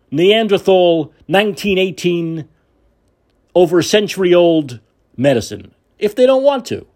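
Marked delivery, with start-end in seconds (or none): none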